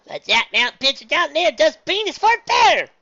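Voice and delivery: high, loud indignant complaint; loud protest. Acted. Granny protesting the pitcher, loud shout. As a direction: protest at full voice. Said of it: "Granny protested".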